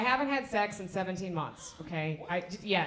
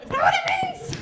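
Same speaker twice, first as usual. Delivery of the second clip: mock outrage; 10 dB louder, slower than usual.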